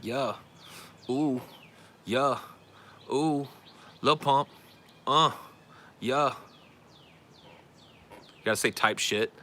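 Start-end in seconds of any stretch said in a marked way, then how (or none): 0.0-6.3 higher-pitched, spaced rapper ad-libs. Pretend. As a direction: punch out mock hype interjections. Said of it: "like, a little rapper".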